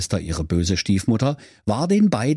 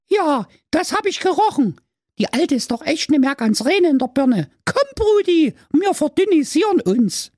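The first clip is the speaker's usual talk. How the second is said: put-on much higher cheeky girl voice; little sister. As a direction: urge him on like a bossy kid. Sister figure.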